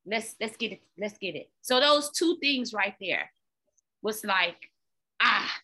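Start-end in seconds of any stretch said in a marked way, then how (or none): 0.1-1.5 quiet self rally. Retold or imitated.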